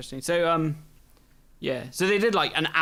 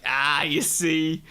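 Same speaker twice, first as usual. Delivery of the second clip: smug; much slower than usual. Acted. GM smugly voicing the clever clue.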